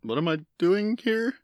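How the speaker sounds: high, halting bewildered question; confused. Acted. Guest dragged into a commercial asks the host, confused. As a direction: act a lost, puzzled guest. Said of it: "like the confusion from her".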